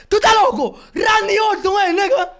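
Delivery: riendo a gritos, muy fuerte; grito eufórico. Real, not acted. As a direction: celebra gritando como loco. Grito eufórico con risa. (grito eufórico entre risas)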